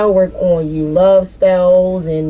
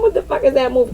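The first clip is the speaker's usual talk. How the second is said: ordinary talk, nothing marked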